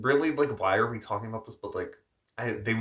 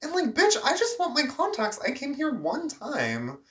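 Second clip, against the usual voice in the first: very high, squeaky exasperation; fed-up rant about just wanting contacts, very high; exasperated. Real, not acted. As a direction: throw your hands up in disbelief.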